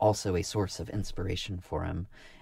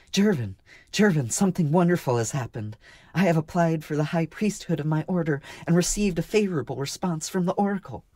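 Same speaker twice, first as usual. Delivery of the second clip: high, louder, elated woman's voice; joyful; 10 semitones higher, 6 dB louder than usual. A narrator's reading. Marka telling Jervin of the oracle's favour, joyful. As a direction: bubble over with wonderful news. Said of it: "she answered joyfully".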